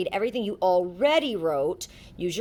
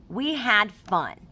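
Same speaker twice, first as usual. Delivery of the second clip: slow, deliberate reading that lands firmly on the period; slow and clear, ending firmly on fun; slow read with a full stop; 2 semitones higher, 4 dB louder, much slower than usual. Shown as a demonstration. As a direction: read it slowly and stop hard at the end. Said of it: "That was the end of that thought".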